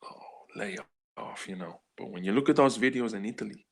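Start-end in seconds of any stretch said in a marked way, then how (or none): 0.0-1.5 breathy whispered brush-off, much softer; dismissive whisper. Retold or imitated. People tired of the warnings, whispering a dismissive lay off. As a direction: hush it like a muttered eye-roll. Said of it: "a lot of people are probably thinking".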